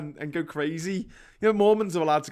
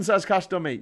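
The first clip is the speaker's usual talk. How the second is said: ordinary delivery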